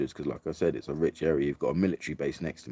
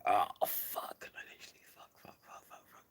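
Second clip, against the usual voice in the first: breathless, rushed whispered swearing; panicked whisper; 3 semitones higher, 9 dB quieter than usual. Retold or imitated. Caught by soldiers, swearing in a rushed whisper. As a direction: hiss the swears in quick panic. Soldiers shout at her to stop.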